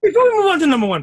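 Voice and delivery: rushed. Real, not acted. Rushes to change the subject.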